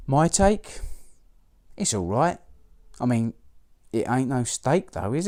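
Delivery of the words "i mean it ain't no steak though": normal talking voice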